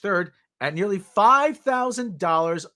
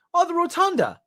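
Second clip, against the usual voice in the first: high mock advisor; 11 semitones higher than usual. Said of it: "advisor's reply".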